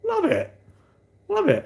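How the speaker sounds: hammed-up giddy squeal; mock delight. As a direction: gush like a kid who's just been shown a trick. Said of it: "It was like".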